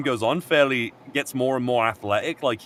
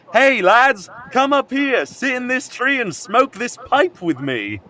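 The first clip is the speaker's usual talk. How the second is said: much higher, louder hammed-up invitation; cheery British lad; 11 semitones higher, 4 dB louder, slower than usual. Jonathan inviting boys to smoke, much higher cheery call. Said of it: "And he's like".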